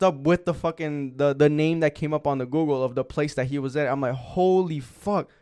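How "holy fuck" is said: drawn-out, higher-pitched disbelief; reliving the shock, slowly; retold astonishment. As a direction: stretch the words out, stunned.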